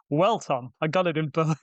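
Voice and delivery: usual speaking voice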